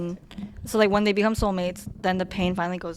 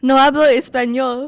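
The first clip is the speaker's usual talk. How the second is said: mock Spanish accent; 4 semitones higher, 9 dB louder than usual. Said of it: "Definitely not English".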